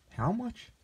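high, startled squeak of a question; a country boy hearing he's rich; stunned disbelief. Acted. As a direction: gasp it out in shock. Youth stunned by the sum.

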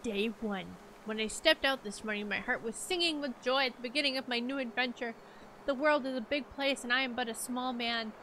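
earnest diary reading; story narration. Acted. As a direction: read like a travel journal. Reads in-game diary aloud.

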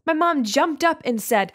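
ordinary delivery

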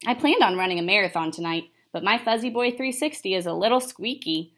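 plain reading voice

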